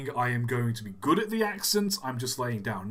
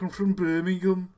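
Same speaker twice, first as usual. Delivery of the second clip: mock Brummie accent, pitched up; Birmingham accent; 4 semitones higher than usual. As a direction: put on a comic Brummie voice. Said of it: "everyone's like".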